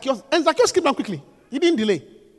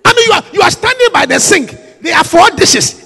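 ordinary talk, nothing marked

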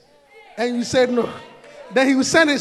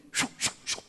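mock shooing; 11 dB quieter than usual. Shooing Isaac's enemies away.

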